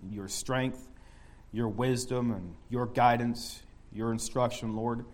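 soft, humble prayer voice. Acted. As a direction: pray quietly and humbly. Leads a prayer.

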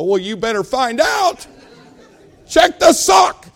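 loud, high shout at the doctor; frantic demand. Retold or imitated. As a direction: yell it out, stretching each word. Acted demand to doctor about toe.

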